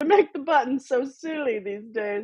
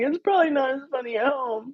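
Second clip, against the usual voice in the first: ordinary talk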